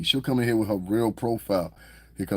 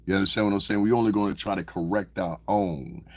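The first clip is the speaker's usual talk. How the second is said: usual speaking voice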